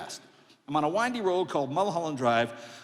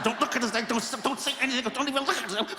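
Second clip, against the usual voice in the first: higher, fast nervous chant; frantic mom; 7 semitones higher, faster than usual. Retold or imitated. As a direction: mutter it to yourself in panic. Mother frantic.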